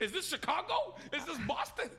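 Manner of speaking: laughing mock question. Real, not acted. Mocking the news, laughing.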